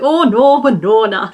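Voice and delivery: cartoon Minnesota cattle voice; Minnesotan accent. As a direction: play up the Minnesotan vowels. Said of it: "it makes me feel all Minnesotan".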